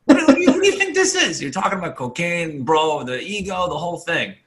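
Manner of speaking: high, squeaky disbelieving rant breaking into laughs; incredulous mock rant. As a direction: ask in a high, laughing, can't-believe-it voice. Retelling his incredulous rant at barber.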